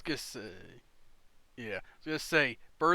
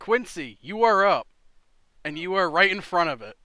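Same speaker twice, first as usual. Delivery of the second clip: loud call; 5 semitones higher, 14 dB louder than usual. Real, not acted. GM calls turn loudly.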